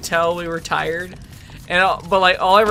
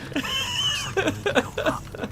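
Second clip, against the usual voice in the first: breathy laugh; 12 semitones higher, 7 dB quieter than usual. Real, not acted. Laughing at retold quote.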